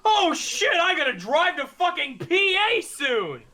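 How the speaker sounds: high, loud oh-no realization; sudden alarm. Real, not acted. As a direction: blurt it as it hits you. Suddenly remembers a drive.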